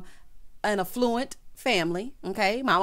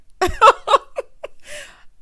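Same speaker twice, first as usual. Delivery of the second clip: high, loud burst of laughter; loud laughing; 9 dB louder than usual. Real, not acted. Recapping husbands playing pool through the ruckus, loud cackling.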